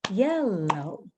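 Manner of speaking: "Yellow" drawn out as two beats, yel-loh; clapped syllables. Shown as a demonstration. Teacher claps it with children, "Yellow" in two slow beats. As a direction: say Yellow slowly, as if clapping each half. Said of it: "let's clap it".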